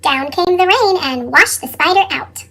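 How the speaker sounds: plain reading voice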